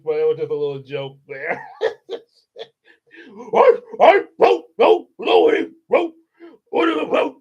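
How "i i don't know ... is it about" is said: much higher, loud silly voice; hammed-up shrill father. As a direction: overplay the father mockingly. Mock father voice, laughing.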